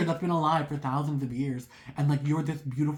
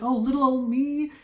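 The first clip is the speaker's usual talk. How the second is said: much higher, louder, playfully bashful; mock coy; 9 semitones higher, 4 dB louder than usual. The viewer playing innocent about her magnetism, mock coy. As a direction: fake modesty, hamming it up.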